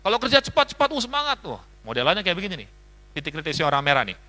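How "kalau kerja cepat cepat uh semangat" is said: impatient boss. Retold or imitated. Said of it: "nggak suka yang lambat-lambat".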